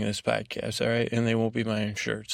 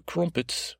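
put-on higher-pitched stab at an English accent; attempted English accent; 7 semitones higher than usual. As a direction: test out a mock English accent on one word. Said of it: "English accent".

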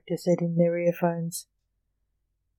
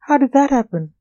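higher, louder startled question; mildly shocked; 6 semitones higher, 7 dB louder than usual. A narrator's reading. John asks about the bucket that appeared, mildly shocked. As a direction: ask it in mild shock. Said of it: "he looked shocked".

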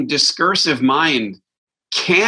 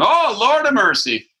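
movie exclamation; 9 semitones higher than usual. Retold or imitated. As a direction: ham it up like a movie hero pleading. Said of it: "characters in movies are going".

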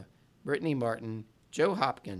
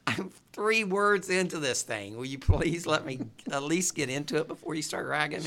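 louder and higher, laughing in frustration; exasperated protest; 4 semitones higher, 5 dB louder than usual. Real, not acted. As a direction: push back, half-laughing. Host protests being interrupted.

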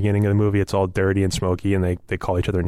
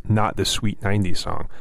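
ordinary talk, nothing marked

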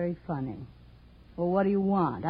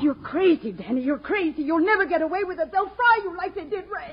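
higher, rising panic; frightened pleading; 8 semitones higher than usual. Acted. Brenda pleads in rising fear with Danny holding a gun. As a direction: beg him as fear builds.

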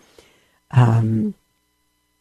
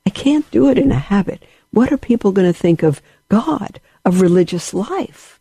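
inner voice; 6 semitones higher than usual. Retold or imitated. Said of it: "that I said".

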